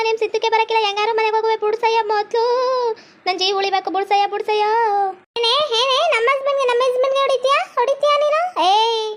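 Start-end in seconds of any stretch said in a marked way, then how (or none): 5.4-9.1 high, sharp scolding woman's voice; angry wife. Acted. Wife scolding the rival who hit her husband, high and angry.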